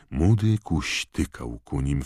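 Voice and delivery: ordinary delivery, nothing marked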